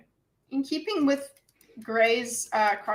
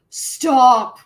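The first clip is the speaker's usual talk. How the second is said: loud stop; 9 dB louder than usual. Retold or imitated. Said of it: "it's not like".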